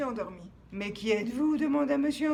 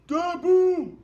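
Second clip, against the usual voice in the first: shouted wake-up call; loud shout; 5 semitones higher, 12 dB louder than usual. Acted. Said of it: "disait la voix".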